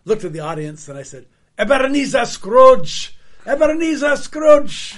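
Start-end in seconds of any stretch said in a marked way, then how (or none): 1.6-5.0 put-on booming slow Scottish accent calling out. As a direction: bellow the name in a big Scottish accent. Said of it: "would it be okay if I did my Scottish accent".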